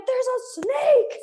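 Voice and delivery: very high, loud, drawn-out cry; hammed-up exasperated alarm; alarmed shout. Hiker answering friend who asked what's going on, retold alarmed shout. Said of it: "And I was like".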